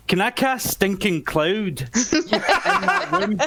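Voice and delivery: slow, breaking into giggles; real laughter spilling into the words; laughing. Real, not acted. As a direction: ask it through mischievous laughter. Player laughs while proposing a spell.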